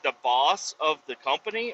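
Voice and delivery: ordinary delivery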